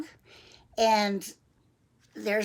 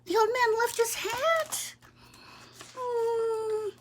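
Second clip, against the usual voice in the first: put-on very high, slow child voice; little boy; 13 semitones higher, slower than usual. Boy noticing the forgotten hat, concerned. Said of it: "he says".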